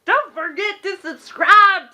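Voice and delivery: put-on high, cheery Texas accent sign-off; hammed-up Texas accent outro. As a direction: whoop it up in a Texas accent, then slow down for subscribe. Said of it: "That's my Texas accent".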